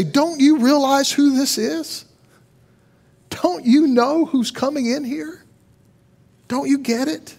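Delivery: a lightly voiced stone, insistent and imploring; mock shout. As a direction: voice the stones urging the crowd, not yelling. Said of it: "the very stones would shout".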